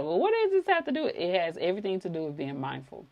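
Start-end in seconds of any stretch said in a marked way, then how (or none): 0.0-1.1 mock doubting viewer voice, much higher and fast; skeptical viewer. Imitating a doubtful viewer asking what's the point, mock high voice. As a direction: play a puzzled heckler, high and quick. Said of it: "somebody's like".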